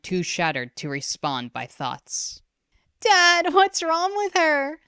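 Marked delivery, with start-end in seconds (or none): 3.0-4.8 high, slow frightened sister; crying out. A narrator's reading. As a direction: beg dad to explain. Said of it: "Ren cried".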